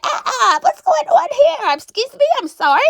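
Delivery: high, startled, laughing; squeaky shocked excuse me; shocked interruption. Retold or imitated. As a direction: stumble in on them, flustered. Voicing Dawn catching them kissing.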